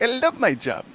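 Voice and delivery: high, eager little hobbit voice; a hobbit gushing about his job, high and bright. Acted. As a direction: squeak it out like a delighted little hobbit. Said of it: "He's like".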